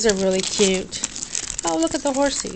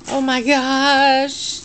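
slow, worn-out sigh of a phrase; dragging the words out, too tired to think; tired drawn-out groan; 3 semitones higher, much slower than usual. Real, not acted. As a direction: drag it out like you're exhausted. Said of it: "See how tired I am?".

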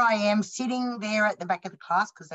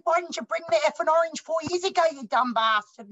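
exasperated outburst; 7 semitones higher, 3 dB louder than usual. Retold or imitated. Exploding at the teacher.

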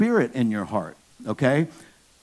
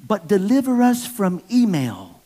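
put-on little girl praying; 6 semitones higher than usual. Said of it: "she prayed".

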